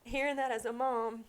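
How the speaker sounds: ordinary talk, nothing marked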